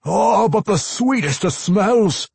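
slow, high, loud craving; rapturous dwarf vampire wail; Pwent. A narrator's reading. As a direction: savour it as a blood-hungry dwarf.

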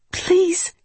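high-pitched plea; begging. A narrator's reading. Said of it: "Intisar begged".

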